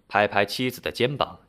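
ordinary delivery

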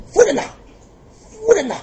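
high, loud calls to his wife; calling out. Acted. Prince calling his sobbing wife, loud anxious call.

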